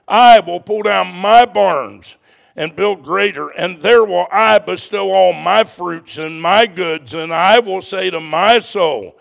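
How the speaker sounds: loud, high, slow pulpit reading; sustained, ringing Bible reading with drawn-out phrases; declaimed scripture reading. Real, not acted. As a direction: proclaim the parable to the whole congregation. Reads the scripture passage aloud.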